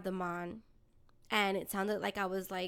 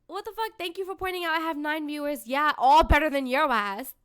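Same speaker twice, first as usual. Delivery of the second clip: indignant rant; 4 semitones higher, 7 dB louder than usual. Real, not acted. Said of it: "ranting at troll".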